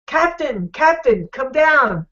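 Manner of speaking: mock holler, voice pitched high; calling up from below. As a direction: holler for the captain from below deck. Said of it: "hollers up".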